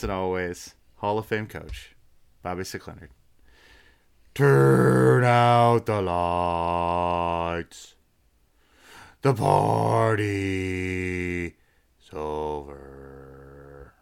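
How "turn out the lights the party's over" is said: very slow, drawn-out spooky voice; creepy haunted house voice. Acted. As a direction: stretch each word like a haunted house ghoul. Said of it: "was that your creepy haunted house voice?".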